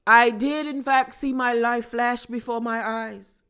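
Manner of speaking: mock a grand, theatrical confession with a put-on accent; overly profound Downton Abbey accent, imitating Meredith; Meredith's posh accent. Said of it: "this accent and the dramatics and these profound dialogues".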